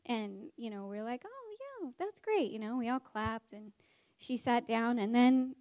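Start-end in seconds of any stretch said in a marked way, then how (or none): 1.2-2.5 high, soft encouraging coo; soft, high-pitched polite praise; lightly acted, high-voiced approval. Class politely applauds a classmate's answer.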